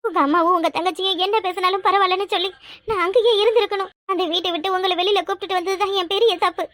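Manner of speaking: ordinary talk, nothing marked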